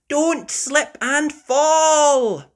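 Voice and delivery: high, loud, slow warning shout. Acted. Mummy Pig warning Daddy, loud and high. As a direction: call out a warning. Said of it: "shouts Mummy Pig".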